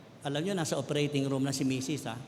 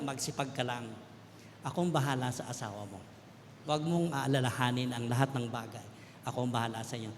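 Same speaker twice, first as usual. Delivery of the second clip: lower, softer reassuring words; gentle voice of God; 6 semitones lower, 6 dB quieter than usual. Retold or imitated. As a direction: softly reassure like the Lord. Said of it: "sabi ni Lord". (malumanay at mahinang boses ng Panginoon, nagpapalakas ng loob)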